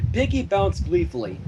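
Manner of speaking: usual speaking voice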